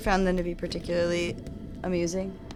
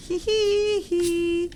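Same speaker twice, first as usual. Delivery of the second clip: slow high giggle; 11 semitones higher, 4 dB louder, much slower than usual. Pretend. Mock giggle.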